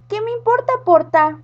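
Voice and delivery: high, loud cheeky witch retort; scared little witch talks back, cheeky. Acted. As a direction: toss it back like a brat witch. Witch answers rudely.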